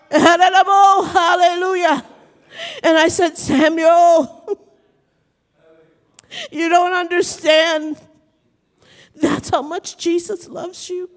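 high, emotional pulpit cry; drawn-out, exultant preacher's exclamation; impassioned preaching. Acted. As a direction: proclaim it, overcome with fervor.

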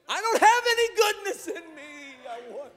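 very high, strained preacher's cry; impassioned preaching. Real, not acted. Preacher confessing need before the congregation, impassioned high-pitched cry.

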